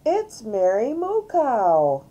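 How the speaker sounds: loud, slow, high announcement; excited cry. A narrator's reading. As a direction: call it out thrilled, stretching the words. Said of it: "cried Emily".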